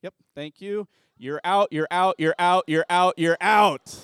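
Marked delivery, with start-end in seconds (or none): 1.2-4.0 loud, playful you're out, rising at each end; gleeful call-out. Real, not acted. Pastor calling kids out of the game in loud playful glee. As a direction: catch them out with a loud teasing call.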